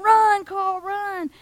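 high, loud shout urging him to run; loud cheer. Acted. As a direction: yell him home from the bleachers. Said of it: "they're going".

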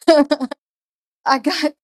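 ordinary talk

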